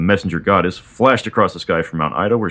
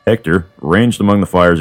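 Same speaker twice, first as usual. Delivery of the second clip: plain reading voice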